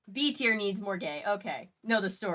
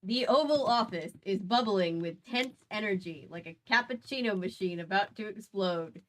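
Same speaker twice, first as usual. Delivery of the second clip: story narration; 3 semitones higher, 7 dB louder than usual. A narrator's reading. Reading the story aloud.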